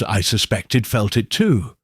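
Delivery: ordinary delivery, nothing marked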